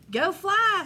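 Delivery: high, loud holler to the cats. Acted. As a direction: call out the command with a push. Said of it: "Santa hollered".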